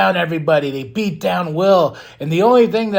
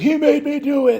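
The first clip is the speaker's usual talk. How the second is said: high whiny plea; mock high pleading; lookalike's plea; 10 semitones higher than usual. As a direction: squeak it out like a caught fake. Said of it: "the guy's like".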